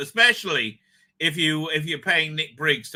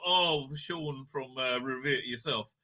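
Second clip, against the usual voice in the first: usual speaking voice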